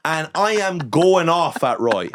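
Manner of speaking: ordinary delivery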